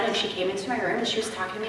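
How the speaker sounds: ordinary delivery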